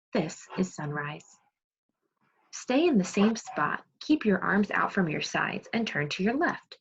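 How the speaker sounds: normal talking voice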